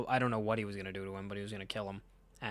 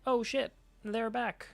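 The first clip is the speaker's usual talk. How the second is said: mock startled alarm; 12 semitones higher, slower than usual. Villain sees enemies return.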